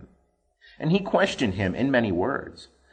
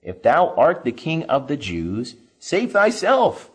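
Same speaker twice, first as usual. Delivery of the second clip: mocking; 6 semitones higher, 4 dB louder than usual. A narrator's reading. Soldiers mocking Jesus while offering vinegar, jeering. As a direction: jeer at him like a soldier. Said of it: "the soldiers also mocked him".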